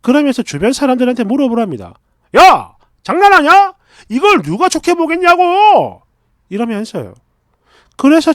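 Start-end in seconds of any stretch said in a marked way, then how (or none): 2.4-6.0 loud, high, acted shout; hammed-up furious boyfriend yelling; angry outburst. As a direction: blow up at your partner, voice raised. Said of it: "이렇게 말을 했더니 버럭해요".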